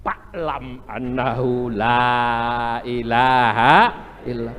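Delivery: "fa'lam" with a p-like f, slow and loud chanted; Sundanese accent. Retold or imitated. Preacher imitating a Sundanese man reciting dhikr with a Sundanese f. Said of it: "Orang Sunda, fa-nya pak".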